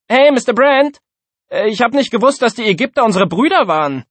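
high, loud wisecracking schoolkid; mouthy class clown piping up; cheeky pupil. A narrator's reading. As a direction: call out to the teacher, pitched right up.

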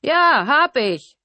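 high, loud, drawn-out breezy yes; breezy admission. A narrator's reading. Killer casually confesses to the sister, loud breezy drawl. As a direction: admit it gleefully, slow and loud. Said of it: "sagte er ganz locker".

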